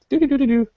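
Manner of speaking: high sing-song doo-doo sounds; playful tool noises. Retold or imitated. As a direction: hum like little busy tools. Imitating surgical tools working.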